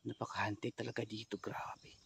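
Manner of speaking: soft, hushed whisper; whispering, spooked by the place. Real, not acted. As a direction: barely breathe the words.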